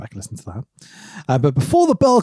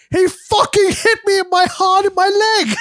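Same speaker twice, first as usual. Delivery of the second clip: mock Sid outburst; 13 semitones higher than usual. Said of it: "Sid clearly goes".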